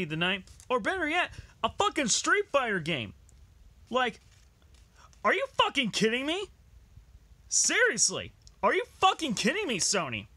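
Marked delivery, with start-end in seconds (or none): 0.7-3.1 outraged rant. Real, not acted. Ranting at Sony's game list. 3.9-10.3 outraged disbelief. Real, not acted. Ranting at Sony's game list.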